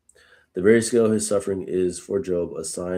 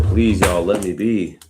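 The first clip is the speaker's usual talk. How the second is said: louder, begging plea to the friends; pleading Job; 3 dB louder than usual. Retold or imitated. Job begging his friends to let him be, pleading. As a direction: beg them to leave you alone. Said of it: "Job is pleading".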